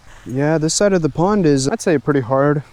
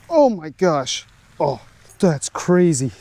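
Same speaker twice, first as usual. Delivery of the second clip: amazed; 4 semitones higher, slower than usual. Real, not acted. Just landed giant fish.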